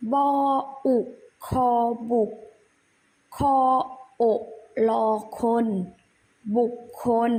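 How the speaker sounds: spelling drill. Shown as a demonstration. Teacher leads children through spelling a word, measured recitation. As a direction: separate syllables with pauses between.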